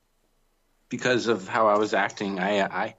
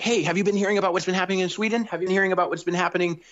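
high, fast, chatty pestering; mock eager questioning; 7 semitones higher, much faster than usual. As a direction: rattle off nosy questions in a raised pitch. Acts out needling he avoided.

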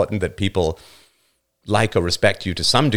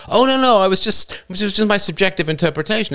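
mock whiny excuse; 10 semitones higher than usual. Mocking a flat-earther's excuse.

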